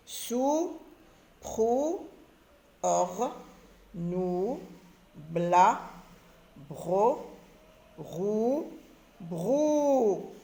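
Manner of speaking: one drawn-out syllable at a time, from "Sou" to "brou"; slow syllable drill, vowels held on "rou" and "brou"; reading syllables aloud. Shown as a demonstration. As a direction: stretch each syllable like "prou", pausing between them. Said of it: "forme et lit des syllabes".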